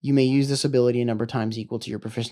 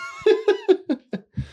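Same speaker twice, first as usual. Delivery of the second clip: high, loud burst of laughing; laughter; 6 dB louder than usual. Real, not acted. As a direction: break out laughing after a serious speech. Breaks into laughter after speech.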